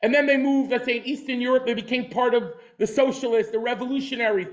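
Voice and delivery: ordinary talk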